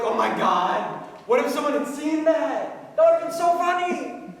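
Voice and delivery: high, giddy acted exclamation; rising into hilarity, imitating giddy friends; cackling friends' reaction. Friends reacting to the microwave accident, giddy. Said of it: "We were all cackling".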